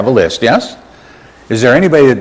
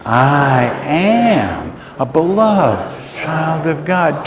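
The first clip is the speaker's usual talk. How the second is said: very slow, higher, sustained declaration; like you mean it; 5 semitones higher, much slower than usual. Shown as a demonstration. Preacher leads congregation to say it like they mean it, slow and sustained. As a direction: draw out each word with conviction. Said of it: "Now say it like you mean it".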